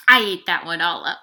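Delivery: a quick greedy boast; gleeful. Acted. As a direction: brag that it's all gone. Eating the chocolate cookie.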